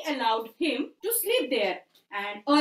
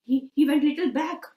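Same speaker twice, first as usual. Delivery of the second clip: usual speaking voice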